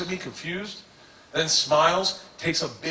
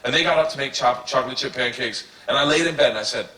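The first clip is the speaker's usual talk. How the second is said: ordinary talk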